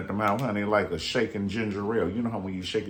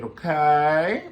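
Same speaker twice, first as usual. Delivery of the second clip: ordinary delivery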